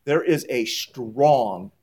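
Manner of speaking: "strong" drawn out slowly with weight; slow, heavy "strong"; stress on strong. Shown as a demonstration. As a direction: lean into "strong", stretch it. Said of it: "I emphasize the word strong".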